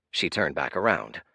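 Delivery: usual speaking voice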